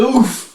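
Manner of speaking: high grunt of being hit; a punched-in-the-gut oof; comic pained oof; mock oof. Character booped in the gut.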